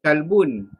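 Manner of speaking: "kalbun" with a light front k; dog word kalbun. Shown as a demonstration. Teacher contrasts the word for dog with heart. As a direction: say "kalbun" with a plain light kaf. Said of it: "pergi guna kaf jadi".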